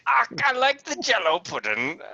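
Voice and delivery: high, loud mock Scottish accent; a hammed-up Scottish brogue on a Cosby voice; Scottish Bill Cosby. On request, a mock Scottish accent for laughs. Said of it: "if you could do a Scottish Bill Cosby".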